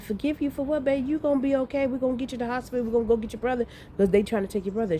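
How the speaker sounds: high-pitched, hurried comforting rush; urgent reassurance. Retold or imitated. Mother promising her sick son the hospital, urgent reassurance. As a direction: replay a worried mother reassuring in one high run.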